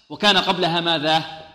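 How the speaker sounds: ordinary talk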